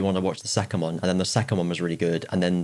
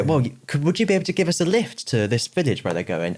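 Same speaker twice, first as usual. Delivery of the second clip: acted line; 7 semitones higher than usual. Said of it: "they go".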